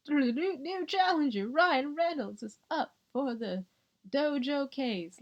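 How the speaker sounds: high, slow hyped announcer call; mock fight announcer. Announcing Ryan Reynolds as the new challenger, hyped.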